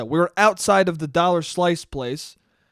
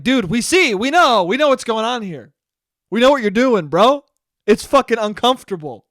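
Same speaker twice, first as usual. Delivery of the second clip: louder, higher calling out; calling her out; 4 semitones higher, 7 dB louder than usual. Retold or imitated. Speaker calls out the hypocrite loudly, louder call-out. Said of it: "It's like".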